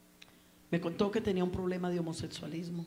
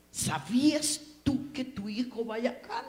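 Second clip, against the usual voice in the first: retold confrontation; 4 semitones higher, 6 dB louder, slower than usual.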